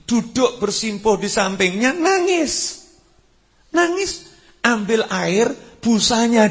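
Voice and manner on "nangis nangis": nangis raised high, voice close to tears; pitched-up weeping word. Retold or imitated. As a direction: lift nangis high, as if about to cry. Preacher voices the saint's weeping.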